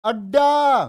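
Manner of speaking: teacher calling out अड्डा to the class; loud demo word. Shown as a demonstration. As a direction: punch अड्डा on its double ड. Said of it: "कितनी बार आया डे डे?".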